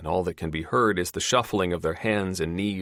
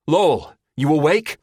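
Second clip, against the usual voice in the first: calling out; 11 semitones higher, 9 dB louder than usual. Acted. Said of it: "Lou calls again".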